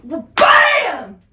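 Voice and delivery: very loud, high, drawn-out bam; explosive smack effect. Retold or imitated. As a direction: shout it like a huge slap landing. Acting out the smack on his ass.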